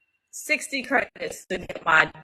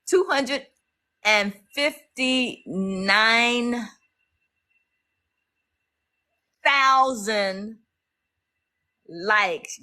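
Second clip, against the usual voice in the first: higher, drawn out count; excited announcement; 4 semitones higher, slower than usual. Real, not acted. Streamer reading the likes count, excited. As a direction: savor the big number.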